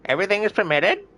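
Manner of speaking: high squawky parrot. Retold or imitated. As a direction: talk like a pet parrot. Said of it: "Doing an impression of a parrot".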